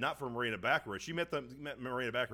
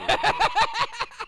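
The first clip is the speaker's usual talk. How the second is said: loud laugh; 8 dB louder than usual. Real, not acted. Bursts out laughing.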